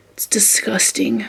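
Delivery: soft, voiceless revulsion; disgusted whisper. Real, not acted. Dog owner after cleaning up vomit, disgusted whisper.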